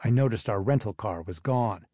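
ordinary delivery

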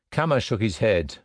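ordinary delivery

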